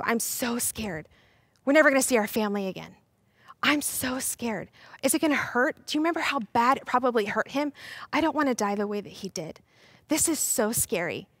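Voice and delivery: acted quiet fear; scared. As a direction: confide your dread in a small voice. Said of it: "and they were scared".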